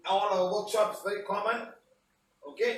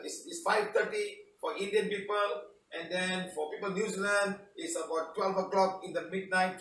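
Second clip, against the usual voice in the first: ordinary delivery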